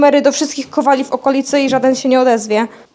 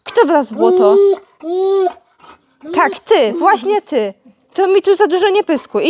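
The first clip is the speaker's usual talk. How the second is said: higher, mock-indignant back-and-forth with the dog; higher teasing pretend quarrel; playful mock argument; 7 semitones higher than usual. Real, not acted. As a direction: argue with the dog like it talked back.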